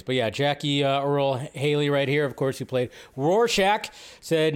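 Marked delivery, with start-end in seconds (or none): none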